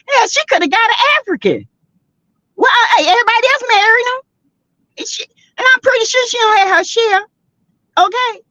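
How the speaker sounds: high, fast mocking tone; high sassy mockery; high sarcastic aside. Real, not acted.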